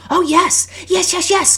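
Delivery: high, loud squeal of delight; excited child. A narrator's reading. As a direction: burst out with thrilled little-girl yeses. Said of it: "hugging her with excitement".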